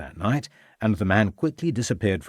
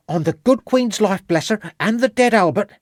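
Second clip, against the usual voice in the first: very high-pitched, earnest lad; boy swearing an oath; 11 semitones higher, 4 dB louder than usual. Acted. Boy interrupts Ravenscroft to swear honesty, high and emphatic. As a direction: cut in high, swearing it's true.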